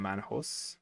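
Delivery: ordinary delivery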